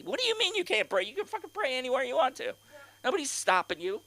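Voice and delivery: high-pitched exasperated disbelief; incredulous rebuttal. Acted. Comic answering the whiners, exasperated disbelief. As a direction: push the pitch up, fed up.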